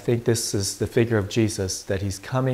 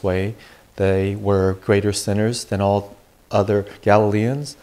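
louder, formal proclamation from the pulpit; Gospel reading; 4 dB louder than usual. Acted. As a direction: read scripture to the congregation.

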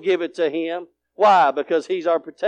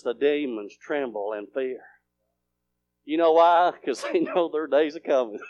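ordinary talk, nothing marked

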